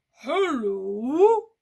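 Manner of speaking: big, booming monster greeting; loud, drawn-out creature voice; Gruffalo. Acted. The Gruffalo greeting Mouse, big loud voice. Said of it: "The Gruffalo says".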